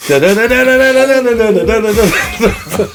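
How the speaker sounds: high quick da-da riff, laughing; vocal guitar riff. Retold or imitated. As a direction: scat the riff while laughing. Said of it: "And it's like".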